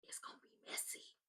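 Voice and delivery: hushed whispered aside. Real, not acted. Vlogger confiding about group drama, whispered aside. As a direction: lean in and whisper it.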